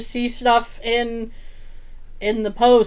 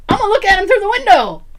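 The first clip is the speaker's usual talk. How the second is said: fast, higher, louder huff of outrage; indignant rant; 9 semitones higher, 6 dB louder, much faster than usual. Retold or imitated. Narrator justifying staring at parked strangers, fast and indignant. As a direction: rattle it off, fed up and defensive.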